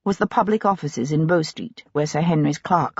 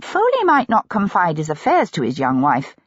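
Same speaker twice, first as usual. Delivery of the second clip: ordinary delivery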